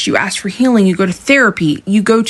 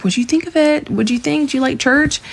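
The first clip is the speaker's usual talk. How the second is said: higher, eager asking; fishing hopefully for his reaction; eager, high questions after the sermon; 7 semitones higher, 3 dB quieter than usual. Retold or imitated.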